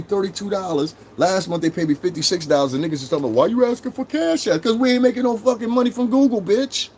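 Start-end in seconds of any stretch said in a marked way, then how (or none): 3.3-4.6 higher, whiny mock of a viewer. Mimicking a chat viewer asking about Cash App, mocking high whine. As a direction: parody the nosy commenter.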